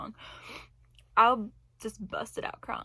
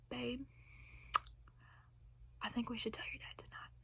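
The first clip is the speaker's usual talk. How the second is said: hushed private whisper; 2 semitones higher, 12 dB quieter than usual. Retold or imitated. Husband pulls her into bedroom.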